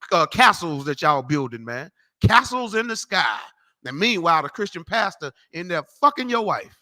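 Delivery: ordinary delivery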